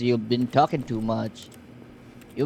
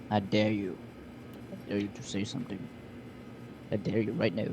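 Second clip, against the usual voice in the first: quiet taunt; 5 semitones lower, 12 dB quieter, faster than usual. Real, not acted. Taunting the silent game narrator.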